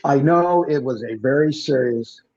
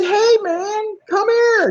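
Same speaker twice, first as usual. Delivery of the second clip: much higher and louder hail across the street; calling out; 4 dB louder, slower than usual. Retold or imitated. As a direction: call him over from a distance. Calling to the guy at night.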